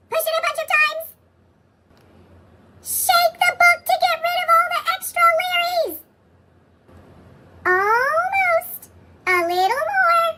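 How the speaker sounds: high squeaky character voice; squeaky cartoon voice. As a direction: play a small excited cartoon. Larry's voice continues.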